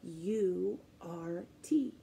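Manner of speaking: letters spelled slowly, fading to a whisper; slow spelling. Shown as a demonstration. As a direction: spell it out gently for kids. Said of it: "our yogurt spelled too".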